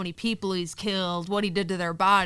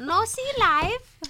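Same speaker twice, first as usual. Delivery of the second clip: much higher, very slow, teasing singsong; mock broken English; 14 semitones higher, 5 dB louder, much slower than usual. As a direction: parrot her words back, teasing. Mocking friend's broken phrase.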